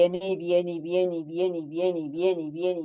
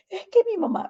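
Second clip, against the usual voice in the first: put-on crying girl; 12 semitones higher, faster than usual. Girl sobbing her answer.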